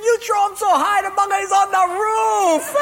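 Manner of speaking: high, loud mocking cackle of a line; laughing taunt. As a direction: crow over the other guy's failure. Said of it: "the Portuguese guy's laughing".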